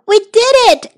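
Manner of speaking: high, loud cry of triumph; excited cry. Acted. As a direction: burst out with joy as the kite climbs. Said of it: "cried Toad".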